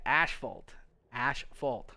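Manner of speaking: ASHFAULT, split as ash-fault; Canadian pronunciation. Shown as a demonstration. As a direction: say ASHFAULT with a "sh" in the middle. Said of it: "how I say asphalt".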